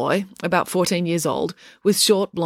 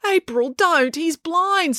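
much higher, urgent; urgent warning; 11 semitones higher, slower than usual. Acted. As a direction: stop her before she strikes. Joe urging April to stop.

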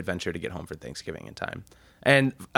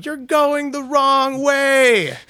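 high, loud, drawn-out yell; mock yelling; 6 dB louder, much slower than usual. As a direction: holler slowly across a highway. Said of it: "they're yelling at him".

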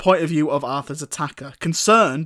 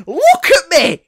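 high, loud outburst; imitated exasperated scream; retold shout; 12 semitones higher, 8 dB louder, slower than usual. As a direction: yell it suddenly, pitched way up. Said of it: "And he just shouts".

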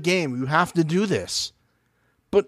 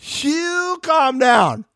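high, very slow, drawn-out comeback; mock retort; 8 semitones higher, much slower than usual. Telling her to calm down, drawn out high in mock outrage.

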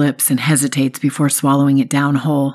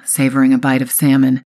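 plain reading voice

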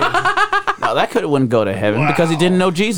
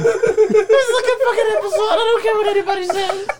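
high squealing laughter through the words; words squeezed out while cracking up; laughing. Real, not acted. Friends lose it on the podcast, laughing through words.

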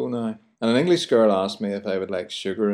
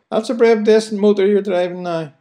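much higher, louder admiring Belfast voice; Belfast praise; 11 semitones higher, 6 dB louder than usual. Shown as a demonstration. Host demoing praise for a new car, high loud Belfast voice. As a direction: gush over the motor in broad Belfast. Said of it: "we'll say".

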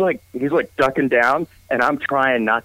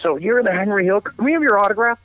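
much higher, eager fan voice; mock awestruck police officer; starstruck cop; 9 semitones higher than usual. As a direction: gush like a cop meeting a celebrity. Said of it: "The police go".

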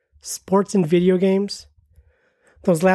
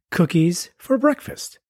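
ordinary delivery

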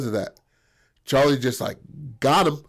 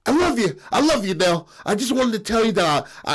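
desperate confession; 8 semitones higher than usual. Acted. Acts Buddy's desperate confession while hanging.

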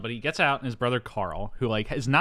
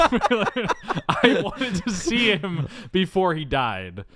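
slow, raised words through laughter; laughing confession; 9 semitones higher, 4 dB louder, much slower than usual. Retold or imitated. Travolta confessing to his dad, laughing through it. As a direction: draw it out while cracking up.

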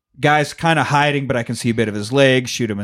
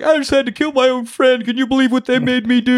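much higher, wailing fake sobs; mock crying; 11 semitones higher than usual. Killer crying to the inspector, hammed-up high wail. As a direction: whine it like a hammed-up weeping gangster. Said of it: "he's even crying to Inspector".

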